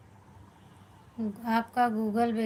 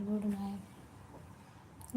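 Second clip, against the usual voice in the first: very slow, much softer "good night" that fades away; drawn-out soft goodnight; 12 dB quieter, much slower than usual. Real, not acted. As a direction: stretch out "good night" slowly and let it trail off. Repeated goodnights closing the stream.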